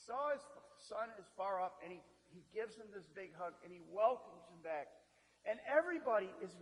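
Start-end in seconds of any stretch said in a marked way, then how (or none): none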